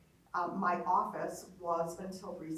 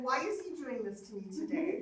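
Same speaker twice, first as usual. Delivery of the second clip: higher-pitched complaint through a laugh; mock exasperation; 7 semitones higher than usual. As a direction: playfully bemoan your bad luck. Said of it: "now I'm not cussing".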